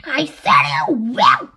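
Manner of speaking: loud curt snap; snapped. A narrator's reading. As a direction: snap it back at her. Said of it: "and Spiker snapped".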